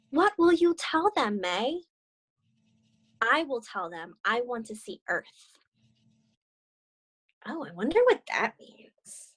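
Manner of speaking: gentle read-aloud storytelling; story narration. Acted. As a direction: read it like a children's picture book. Reads story with character dialogue.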